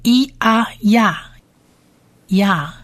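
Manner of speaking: E啊呀 spaced as "ee, ah, ya"; sound-blending demo. Shown as a demonstration. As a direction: blend E and 啊 slowly into 呀. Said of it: "再加上下面这个小T长母音啊".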